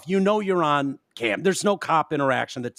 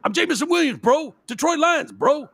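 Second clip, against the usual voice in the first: much higher, cocky bro voice; an athlete bragging about his team to a cop, mocking; mocking entitled athlete; 11 semitones higher than usual. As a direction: ham up the star bragging to a cop.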